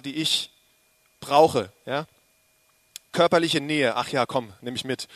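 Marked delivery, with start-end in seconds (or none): none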